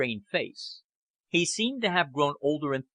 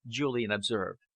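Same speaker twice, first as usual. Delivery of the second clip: ordinary delivery, nothing marked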